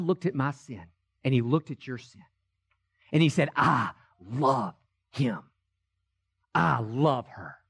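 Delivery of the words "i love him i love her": tender voice of God. Retold or imitated. God speaks lovingly of people.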